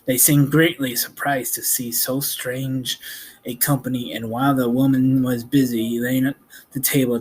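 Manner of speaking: usual speaking voice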